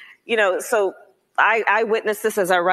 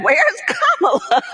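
mock alarmed uncle; 10 semitones higher, slower than usual. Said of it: "and said".

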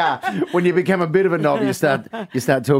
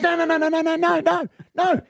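much higher, frantic pleading denial; pleading drunk husband; 9 semitones higher than usual. Acted. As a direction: beg her like a caught-out drunk. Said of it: "He goes".

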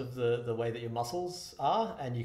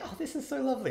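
high polite voice; 14 semitones higher, faster than usual. Retold or imitated. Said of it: "they were like".

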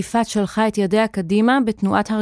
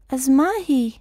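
put-on higher, thin little-girl voice; curious high-pitched question; 5 semitones higher than usual. A narrator's reading. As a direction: ask in a small, thin child's voice. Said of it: "שאלה בקול דק".

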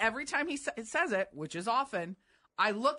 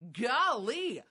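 higher, swooping mimicry of his golly; imitating correspondent's golly; 4 semitones higher than usual. Cohost showing how the correspondent talks, exaggerated golly. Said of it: "He says it like that".

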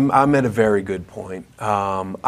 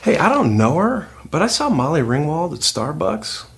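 hammed-up audition chat; 3 semitones higher than usual. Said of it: "reading for Twilight".

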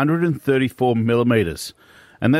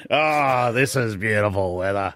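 old man impression; 3 semitones lower than usual. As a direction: sigh it out like a weary old man. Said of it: "You just went".

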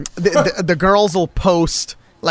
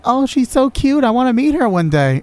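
gushing; 8 semitones higher than usual. Retold or imitated. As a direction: coo over something adorable. Girlfriend gushing over cute cousin.

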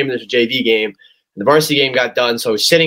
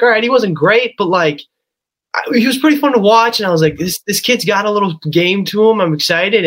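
much higher, bright-eyed hopeful fan voice, imitated; mock naive optimism; 8 semitones higher than usual. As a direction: play your younger self, naively thrilled. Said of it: "and I'm like".

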